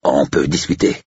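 lightly distorted, hesitant plea; muffled pleading. A narrator's reading. Agent pleads with a gun at his jaw, slightly muffled. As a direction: try to talk past an obstruction. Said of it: "la voix déformée par le canon".

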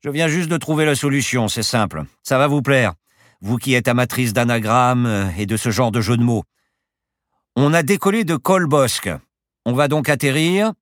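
loud, high, pleased-with-himself explanation; boastful professor at the controls; self-satisfied pilot. Acted. Pilot announces his clever solution.

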